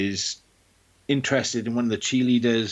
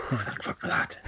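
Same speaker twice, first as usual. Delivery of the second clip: breathy whispered relief; relieved whisper; 4 dB quieter than usual. Retold or imitated. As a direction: whisper it like the danger passed. Relieved whisper after night fear.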